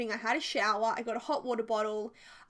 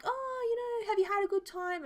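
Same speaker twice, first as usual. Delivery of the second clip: put-on chirpy teens; 9 semitones higher than usual. Teens at front chatting.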